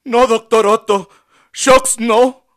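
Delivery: high, loud, tearful refusal; crying plea. A narrator's reading. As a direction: beg through pain, loud and high. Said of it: "lloró con dolor".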